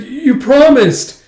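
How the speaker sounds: high, loud, drawn-out protest; protesting cry. Acted. Captive to emperor who broke his word, loud pleading cry. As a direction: wail it slowly in protest.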